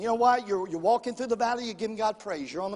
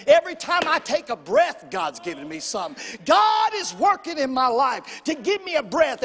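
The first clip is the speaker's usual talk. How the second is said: full-force preaching; 2 semitones higher, 6 dB louder than usual. Real, not acted. Preacher stirring the church to praise God, full-force preaching. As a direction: build the congregation up with loud, emphatic declarations.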